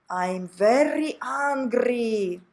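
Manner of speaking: "very" with a strong rolled R, slow and mock angry. Shown as a demonstration. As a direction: roll the R in "very" like you're angry. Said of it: "as if you were angry".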